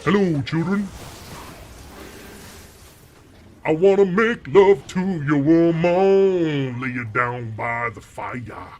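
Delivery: slow, loud demonic Chef voice; Chef from South Park. Retold or imitated. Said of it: "Demon voice sounds like Chef from South Park".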